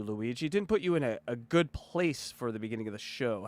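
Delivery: ordinary delivery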